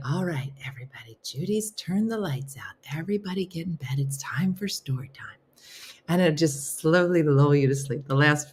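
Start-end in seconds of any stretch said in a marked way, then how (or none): 0.1-5.4 soft whisper, fast at first; bedtime whisper. Pretend. Imagined host tucking viewers in, whispering. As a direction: hush the whole group off to bed. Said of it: "it's gonna be like".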